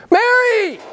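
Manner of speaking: high, loud calling of a name; exclamation. Retold or imitated. Jesus calls Mary by name, exclaiming. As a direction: call out with a big exclamation point. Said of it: "Exclamation point".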